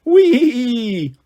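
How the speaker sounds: high, drawn-out whee; excited squeak. A narrator's reading. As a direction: squeal with delight as you fly. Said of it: "Bash squeaked with excitement".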